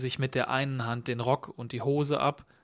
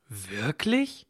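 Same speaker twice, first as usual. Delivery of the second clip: visibly amazed. A narrator's reading. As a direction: ask it in wondering disbelief. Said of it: "sagte Michael sichtlich verwundert". (sichtlich verwundert, fragend)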